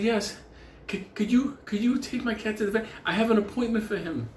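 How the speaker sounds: high-pitched imitation of the neighbor's request; retold pleading neighbor. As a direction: pitch it up like a woman asking a favor.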